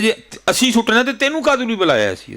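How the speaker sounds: indignant retort pitched high; high, heated mock comeback; angry retort. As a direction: imitate an angry son snapping back. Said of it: "مجھے بڑے غصے میں کہتا ہے".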